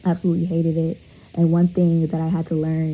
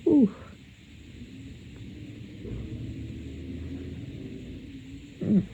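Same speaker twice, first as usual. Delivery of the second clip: shaky breaths fighting back tears; a choked hum while fighting tears; holding back sobs; 2 semitones higher, 10 dB quieter than usual. Real, not acted. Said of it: "so hard to hold back tears".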